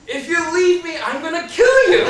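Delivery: high, loud mock threat in her voice; imagined threat. Imagining the wife below yelling up at him, mock furious threat. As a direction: play the furious wife, half joking. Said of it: "I put words in her mouth".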